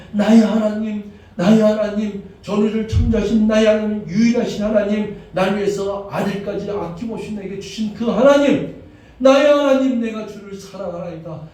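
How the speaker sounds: high, loud crying-out prayer; impassioned cry. Acted. Pastor modelling a full-hearted confession, crying out loud. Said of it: "내가 목나와서".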